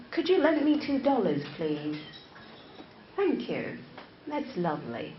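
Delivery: soft, gentle British courtesy; polite British. Shown as a demonstration. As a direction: ask very politely in British English. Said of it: "that's British".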